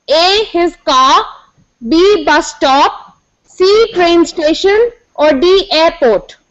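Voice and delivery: slow, spaced out options; slow dictation. Acted. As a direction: read each option out slowly. Reads options slowly.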